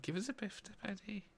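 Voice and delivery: put-on high, soft echo of a Scouse accent; imitating Scouse. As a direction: quietly mimic a mock Scouse line. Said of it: "A Scouse accent".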